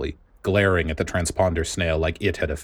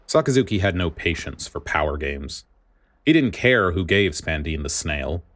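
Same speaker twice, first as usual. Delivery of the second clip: ordinary delivery, nothing marked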